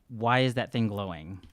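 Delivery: ordinary delivery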